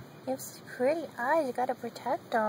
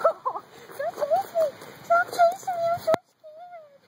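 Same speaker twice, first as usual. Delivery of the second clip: much higher, louder mock-scared squeaky pet voice; voicing the dog; 6 dB louder than usual. Owner speaking for the dog, high mock-scared squeak. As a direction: play the pup pretending to be terrified of being chased.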